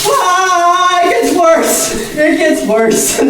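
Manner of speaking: high, strained cry of cold; yelling through the freezing shock. Real, not acted. Second bucket of ice.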